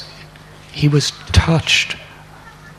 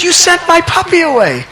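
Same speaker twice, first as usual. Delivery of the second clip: much higher, louder hurt cry; upset accusation; 12 semitones higher, 6 dB louder than usual. Acted. Boy accusing his mother of sending the puppy away, high and upset. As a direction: blurt out the accusation.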